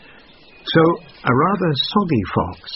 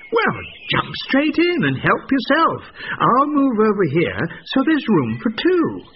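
sly invitation; 12 semitones higher than usual. A narrator's reading. Fox luring goat into well.

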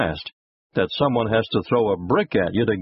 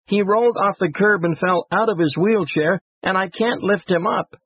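put-on high, pleading child voice; distressed young boy; 9 semitones higher than usual. A narrator's reading. Boy explaining his brother fell from the wheelchair, distressed pleading. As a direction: pour it out like an upset little kid, pitched high. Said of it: "Sobbing, the boy asked the executive".

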